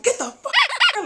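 mock furious mom throwing her out; mom yelling. Mom throwing the girlfriend out of the room, high mock yell.

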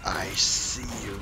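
slow whispered eye voice; creepy whisper. Pretend. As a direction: whisper it slowly like a watching eye. Voicing the giant eye.

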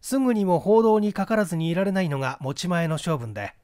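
plain reading voice

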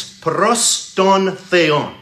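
"pros ton theon" said slowly in Greek, each word separate; Greek phrase. Shown as a demonstration. Preacher citing the Greek preposition, slow and careful. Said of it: "In Greek, it's".

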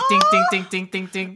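high, ringing imitated chisel taps; tiny hammer dings. Shown as a demonstration. Player shows how the mending spell sounds, imitated dings. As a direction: start high and loud, then drop back.

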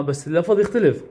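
ordinary delivery

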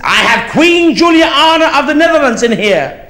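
mock Dutch driver boasting about the queen in his car; pompous driver. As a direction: announce the royal passenger grandly. Dutch driver pulling rank.

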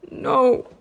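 sudden loud strained no; croaked. A narrator's reading. Bella in agony, pleading against the vampire, loud strained croak. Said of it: "I croaked".

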